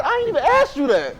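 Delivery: high-pitched incredulous comeback; mock disbelief. Preacher answering an unasked-for reply, high mock disbelief. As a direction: protest in a high, baffled voice. Retold baffled reply to acquaintance.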